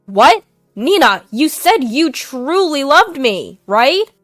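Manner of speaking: cling in disbelief; husband shocked to hear mistress, loud and high; shocked plea. Acted.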